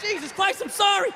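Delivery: high, strained apology; frantic apology. Retold or imitated. Owner pulling the dog back from another guy, frantic. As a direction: cry it out high, mortified by your dog.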